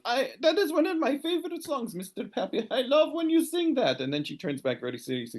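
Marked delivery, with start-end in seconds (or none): 0.1-4.0 high, gushing woman's voice; high excited lady praising his song; delighted lady. Acted. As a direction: gush with delight at the singer. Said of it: "she also goes".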